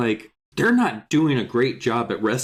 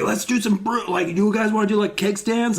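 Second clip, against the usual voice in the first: party-guy Alex; 8 semitones higher than usual. Retold or imitated. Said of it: "Alex is like".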